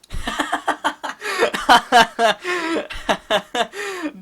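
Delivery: long high burst of real laughter; big laugh. Real, not acted. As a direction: crack up hard at the prank. Reacting to prank reveal.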